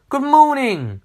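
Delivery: high, cheerful sing-out greeting; merry storybook hello; cheery Mister Happy. A narrator's reading.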